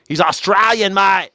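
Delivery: mock Australian accent. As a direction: exclaim it in exaggerated Australian. Mock Aussie insisting on Murdoch's origin.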